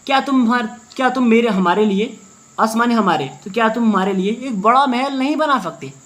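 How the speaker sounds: ordinary talk, nothing marked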